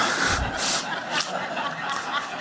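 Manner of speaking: a quiet breathy chuckle at the nickname; soft laugh. Real, not acted. Friend recalling his nickname at a memorial, soft laugh. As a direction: let a small airy laugh slip out.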